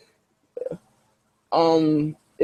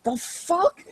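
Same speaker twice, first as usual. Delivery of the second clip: high, groggy disbelief; a neighbor woken up, high and groggy; sleepy neighbor; 12 semitones higher, 7 dB quieter than usual. Acted. Neighbor woken at three am, high groggy disbelief.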